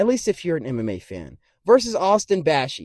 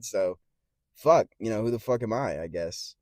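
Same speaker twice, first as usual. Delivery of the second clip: normal talking voice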